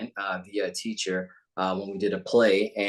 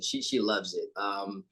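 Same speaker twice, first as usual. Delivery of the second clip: ordinary delivery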